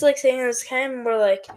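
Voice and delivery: ordinary talk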